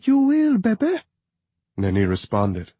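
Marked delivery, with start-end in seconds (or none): 0.1-1.0 cracking, tearful reassurance. A narrator's reading. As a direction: voice breaking as she reassures. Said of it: "her voice cracking".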